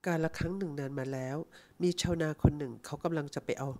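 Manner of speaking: put-on old man softly telling a fable; fairy-tale telling. A narrator's reading. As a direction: ease into a bedtime tale for the girl. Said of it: "ฉันอยากจะเล่านิทานให้หนูฟังสักเรื่องนะ".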